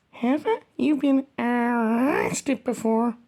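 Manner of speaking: ordinary talk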